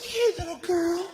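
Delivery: high-pitched cooing wife voice; mock-tender greeting to a newborn; imitated new mother. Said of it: "She's like".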